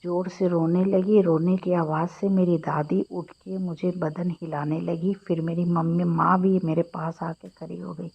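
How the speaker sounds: ordinary delivery, nothing marked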